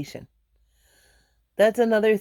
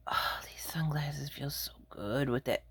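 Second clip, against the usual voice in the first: whisper; 6 semitones lower, 13 dB quieter than usual. Real, not acted. Migraine relief, whispering.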